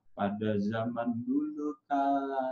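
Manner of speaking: slow, soft fairy-tale opening; hushed, unhurried narrator voice; storyteller narration. Shown as a demonstration. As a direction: draw out the once-upon-a-time slowly. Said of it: "ngedongeng ada narasinya nih".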